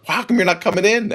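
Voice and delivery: high called-out question from downstairs; wife calling from the basement, high. Retold or imitated. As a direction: call up to him from the basement, high. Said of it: "she's calling me like".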